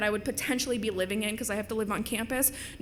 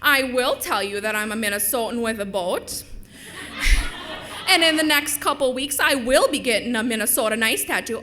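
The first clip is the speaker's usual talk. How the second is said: hammed-up Minnesotan; Minnesota accent; 4 dB louder than usual. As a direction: play up a comic Minnesota accent. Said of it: "the accent from the show".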